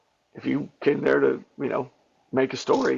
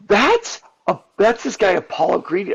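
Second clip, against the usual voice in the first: much higher, excited shout of recognition; 6 semitones higher, 4 dB louder than usual. Retold or imitated. Kids pointing out Apollo Creed, much higher and excited. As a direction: kids spotting a famous face.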